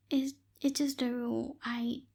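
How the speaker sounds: usual speaking voice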